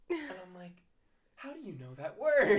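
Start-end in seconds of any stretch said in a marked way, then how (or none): 1.4-2.6 louder, higher amazement; amazed question. Retold or imitated. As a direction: sound floored by the kid. Amazed at the student's word.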